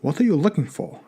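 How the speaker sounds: put-on grandfather's heavy Russian accent. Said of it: "words soaked in the heavy Russian accent".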